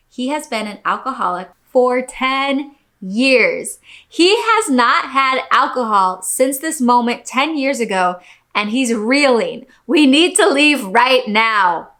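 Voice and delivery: loud, indignant outburst building to a forceful demand; upset wife's outburst. Acted. Wife tells server her sober husband was served alcohol, upset and loud. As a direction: raise your voice and insist as the wronged wife.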